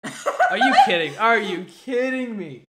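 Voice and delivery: loud, slow, laughing disbelief; incredulous. Real, not acted. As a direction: drag it out in laughing shock. Disbelief at the flip offer.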